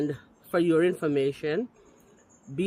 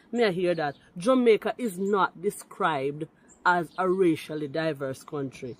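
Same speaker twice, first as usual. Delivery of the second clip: ordinary talk, nothing marked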